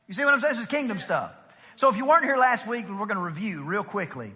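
ordinary talk, nothing marked

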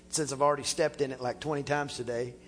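ordinary delivery

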